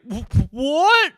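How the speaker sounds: high stammered disbelief; shocked man-child. Acted. Grown son learns there's no Tooth Fairy, shocked. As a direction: react like a stunned overgrown kid.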